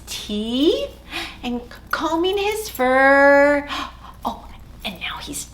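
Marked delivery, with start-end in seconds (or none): 4.2-5.5 whisper. Acted. Whispered narration.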